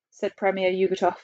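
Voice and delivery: ordinary delivery, nothing marked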